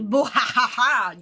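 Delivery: lightly imitated villain cackle; a quick menacing chuckle; evil laugh. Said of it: "that Merrick jump scare right there".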